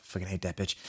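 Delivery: deadpan aside; quiet aside. Acted. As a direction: throw it away under her breath. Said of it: "quiet aside".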